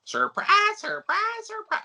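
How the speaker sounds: very high sing-out catchphrase; Gomer Pyle. Acted. Host quoting Gomer Pyle's catchphrase from an old show, high-pitched. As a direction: crow each word in a high comic voice. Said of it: "the guy that played Gomer Pyle".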